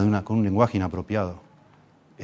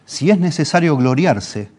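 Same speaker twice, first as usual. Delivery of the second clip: different tone; 5 semitones higher, 5 dB louder, slower than usual. Retold or imitated. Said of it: "empieza como en un tono distinto".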